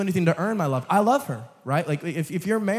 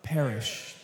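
dark low drop; 4 semitones lower, 6 dB quieter than usual. Acted.

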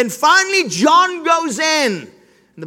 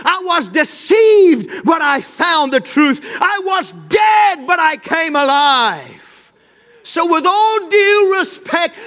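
impassioned testimony. Acted. Mary crying out her story to the gardener, rising preached shout. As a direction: swell from plea into full-voiced proclamation.

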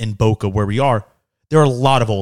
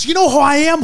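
very high, haughty mock challenge; pitched way up in mock outrage; indignant woman; 14 semitones higher, 5 dB louder than usual. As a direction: imitate a stranger pulling rank. Imitating woman flaunting gift card.

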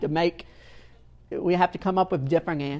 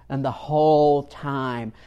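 usual speaking voice

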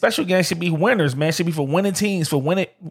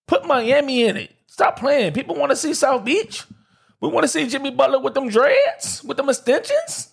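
high-pitched, worked-up fan plea; excited pleading; 10 semitones higher than usual. Real, not acted. Fan demanding Miami on the Christmas schedule, excited and high. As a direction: voice way up, insisting in disbelief.

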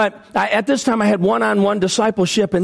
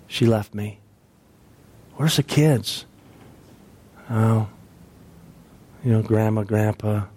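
broken, quiet grief; 7 semitones lower, 3 dB quieter than usual. Retold or imitated. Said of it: "he just started bawling".

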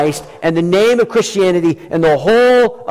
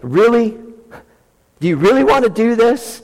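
higher, incredulous mock challenge; God mildly teasing, a touch higher; God taunting rebels; 5 semitones higher than usual. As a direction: scoff at a puny challenger, amused. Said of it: "This is God saying".

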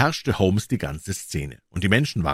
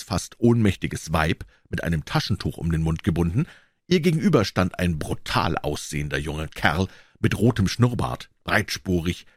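ordinary delivery

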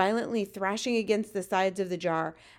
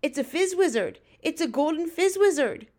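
halting, then rising into louder excitement; thrilled; 6 semitones higher, 5 dB louder than usual. A narrator's reading. Giant discovers a rare golden dream, thrilled. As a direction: stammer into delighted discovery, pitch climbing. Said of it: "with a thrill in his voice".

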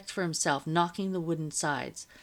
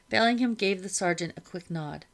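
plain reading voice

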